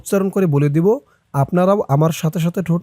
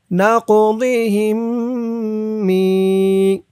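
slow melodic tajweed chant; Quran recitation; 5 semitones higher, much slower than usual. Shown as a demonstration. Recites phrase. (ধীর সুরেলা কুরআন তিলাওয়াত)

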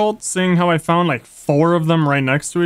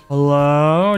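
ordinary talk

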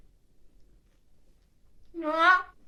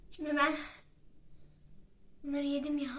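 ordinary talk, nothing marked

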